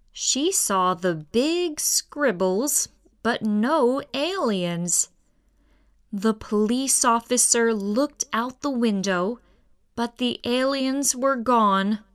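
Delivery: ordinary delivery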